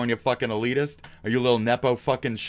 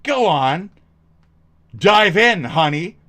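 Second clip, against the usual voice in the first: mock taunt; 8 semitones higher, 10 dB louder, slower than usual. Taunting viewer, mock invitation.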